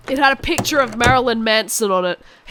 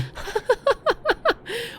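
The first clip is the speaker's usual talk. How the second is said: shocked laugh; 12 semitones higher, 6 dB quieter than usual. Acted. Shocked at villain's proposal.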